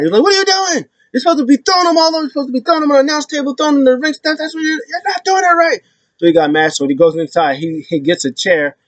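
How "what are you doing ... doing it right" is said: much higher, fast mock-angry rant; mad Ciampa. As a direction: rant like a furious tag partner. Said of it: "Tommaso Ciampa's mad".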